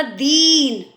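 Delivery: "दीन" with the ee stretched; held ee in "दीन"; long ee. Shown as a demonstration. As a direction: draw out the long vowel in "दीन". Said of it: "तो बड़ी आवाज़".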